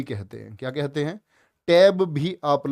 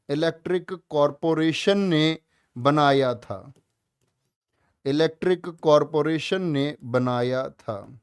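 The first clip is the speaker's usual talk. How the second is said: slow and clear for note-taking; slow dictation; slower than usual. Shown as a demonstration. Teacher dictating the answer slowly to students. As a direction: spell it out slowly so they can write. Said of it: "लिखना इसको".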